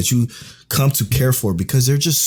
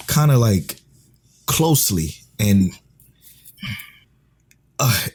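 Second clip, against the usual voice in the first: ordinary delivery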